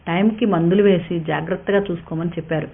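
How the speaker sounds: ordinary delivery, nothing marked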